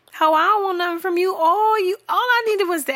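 fast, high-pitched protest; squeaky indignant outburst; exasperated rant. Acted. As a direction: rattle it out high, thoroughly irked. Ranting at man not calling back.

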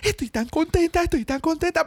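high hushed mock excitement; whispering on the phone. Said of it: "Fue bien extraño. Fue como". (susurrando al teléfono, muy agudo)

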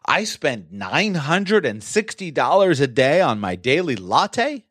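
high, slow, disbelieving question rising at the end; stunned disbelief, pitch leaping on the last word; incredulous tone. Shown as a demonstration. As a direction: question the expense in drawn-out astonishment. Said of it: "Changes the tone a little bit".

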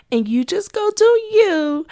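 high, loud, slow sassy send-off; sassy dismissal. Pretend. To a negative coworker, a loud sassy brush-off. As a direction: wave them off with a singsong sass.

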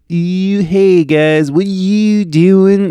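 high, exaggerated Pennsylvania vowels, drawn out slowly; mock Pennsylvania accent. Shown as a demonstration. Showing listeners how Pennsylvanians talk, mock accent. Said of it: "But Pennsylvania has this, like, ew".

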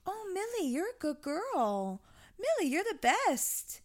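high-pitched, drawn-out praise for a dog; singsong good-girl voice; sweet dog praise. Shown as a demonstration. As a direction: coo to the dog, slow and high. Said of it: "talk nicely to them".

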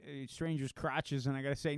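normal talking voice

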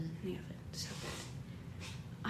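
breathy whispered aside, almost no voice. Real, not acted. Telling her co-host the oven tip in a whisper. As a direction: drop to a secret whisper. Aside about baking temperature.

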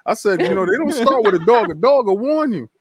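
hamming up being creeped out alone in the house; mock spooked.

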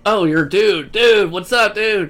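loud, high mock bro voice; hammed-up schoolboy calling everyone dude; imitating dudes greeting each other at school. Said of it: "we're like".

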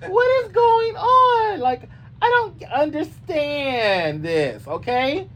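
high, slow, stretched-out mama voice; hammed-up outraged mama, slow and high; Mama Joyce voice. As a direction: play a baffled mother, drawn out and high. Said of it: "in my Mama Joyce voice".

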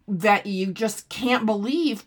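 usual speaking voice

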